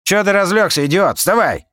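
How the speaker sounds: high, loud angry yell. A narrator's reading. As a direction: yell at the idiot on the floor. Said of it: "прокрикнул на Дуримара".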